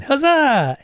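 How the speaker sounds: high, loud cheer; huzzah. Real, not acted. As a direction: cry out in triumph. Celebrates slaying dragon.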